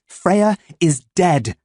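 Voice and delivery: slow, firm, final; mother stating a hard fact firmly. Acted. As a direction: drop it like a verdict. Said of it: "Mum said firmly".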